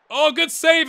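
sudden loud, high burst of relief; excited exclamation. Real, not acted. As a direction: blurt out praise for the keeper, loud. Reacts to keeper's save.

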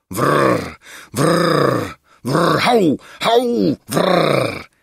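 rolled growls with high barks; lion growls and barks. A narrator's reading. Lions devouring the bearded man, growling and barking. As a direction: growl and bark like attacking lions. Narrator imitates the lions attacking.

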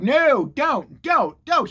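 high, rising pleas to stop; urgent warning. Acted. Speaker warning her about red flags, urgent.

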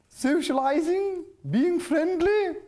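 a hint of a prim, fussy bookworm with halting pauses; mock-formal bookworm voice, not far from his own; imitating Bookie Worm. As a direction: lightly copy the bookish friend's proper talk. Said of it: "I'm just trying to talk like you".

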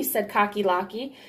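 ordinary delivery

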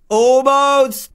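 high, loud, drawn-out cry; exasperated yell, pitched right up; frustrated near-miss. Real, not acted. Narrowly misses a jump again.

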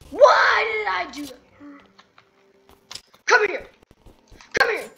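loud anguished cry; dismayed shout. Real, not acted. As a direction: wail at your own mistake. Dismayed after mistake.

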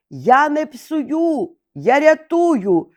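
high, slow cry with last strength; high, slow, exhausted cry of a crow; straining last cry. A narrator's reading. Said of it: "з останніх сил крикнув Ворон".

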